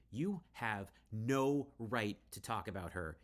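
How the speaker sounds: loud, slow furious shout; angry scream. Acted. Emerson defending her mother, slow furious shout. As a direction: let the anger out at her. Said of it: "you scream".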